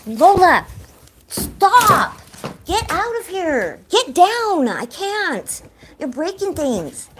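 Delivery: high, loud frantic shouts at a pet; real exasperated yelling at a destructive cat. Real, not acted. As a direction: shriek at the cat to get down.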